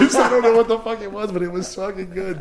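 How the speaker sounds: cracking up mid-sentence; laughing. Real, not acted. Laughing as he tells his friend about the party stew.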